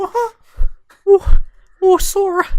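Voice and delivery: very high, loud cartoon squeak; a failed squeaky mouse impression; Mickey Mouse voice. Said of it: "I can't do a Mickey Mouse voice".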